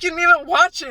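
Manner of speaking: mock scolding. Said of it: "And it was like".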